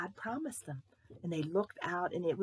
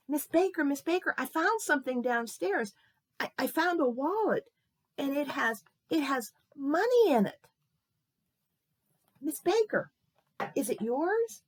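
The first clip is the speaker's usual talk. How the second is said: put-on high, loud, eager child's voice; excited boy; 7 semitones higher, 6 dB louder than usual. Boy tells neighbour about found wallet, excited. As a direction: blurt out the big discovery.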